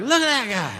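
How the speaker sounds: exaggerated high, slurred mocking cry; mocking jeer. Denying they ever said it like that, exaggerated mocking voice. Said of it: "We never said it like that".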